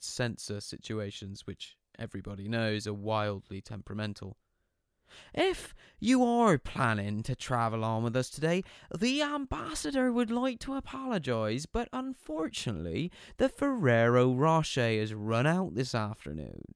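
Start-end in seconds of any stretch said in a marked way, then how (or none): 5.3-16.6 high, far-off mock PA announcement; sarcastic train guard over the tannoy; sarcastic train announcer. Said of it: "use the P A system to practice his stand-up comedy".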